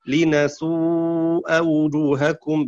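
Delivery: لنسوء read linasū'a, with nun. Shown as a demonstration. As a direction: say لنسوء with a clear n. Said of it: "بالنون".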